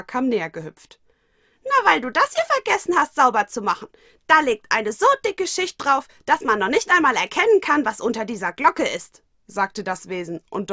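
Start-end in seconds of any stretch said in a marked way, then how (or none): 1.6-9.2 very high, loud squeaky creature voice; high-pitched critter voice, loud and sassy; cheeky little creature. Acted. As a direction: play a tiny mischievous creature pointing out dust. Mokona character voice.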